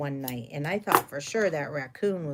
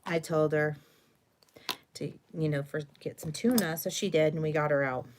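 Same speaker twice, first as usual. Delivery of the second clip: usual speaking voice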